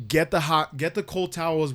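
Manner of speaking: acted alarm, high and loud; panicked orders. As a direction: call out orders in mock urgency. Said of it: "now I'm panicking".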